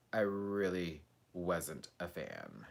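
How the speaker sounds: a fading murmured repeat; soft, slow and low. Shown as a demonstration. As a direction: let it fade to almost nothing. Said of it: "repeated line fading".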